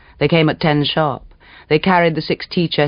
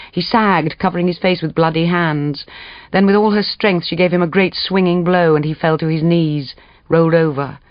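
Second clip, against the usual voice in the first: ordinary delivery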